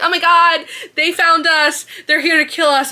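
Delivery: mock alarm from the fairy court; panicked fairies. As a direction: cry it out as if under attack. Fairies panic thinking attack.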